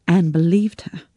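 plain reading voice

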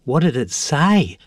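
mildly breathless curiosity; breathless question. A narrator's reading. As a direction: beg to hear what happened. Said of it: "asked Jack breathlessly".

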